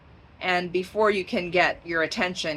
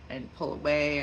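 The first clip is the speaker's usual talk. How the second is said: usual speaking voice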